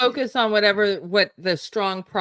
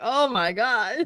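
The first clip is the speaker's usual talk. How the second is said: higher-pitched, laughing retold dismay; laughing exclamation; 6 semitones higher, slower than usual. Retelling yesterday's reaction at the grocery store, laughing. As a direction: relive the shock through laughter. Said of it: "I'm laughing 'cause".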